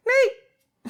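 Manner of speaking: high loud call into the dark. Retold or imitated. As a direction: yell the name from hiding. Calling from darkness to scare.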